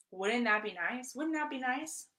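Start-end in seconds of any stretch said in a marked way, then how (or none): 1.1-2.1 higher, dreamy wish; wistful wishing voice; wistful longing. Shown as a demonstration. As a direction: sigh it out like a daydream. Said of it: "That's a desire".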